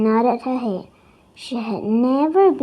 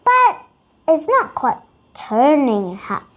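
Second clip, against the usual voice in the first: ordinary delivery, nothing marked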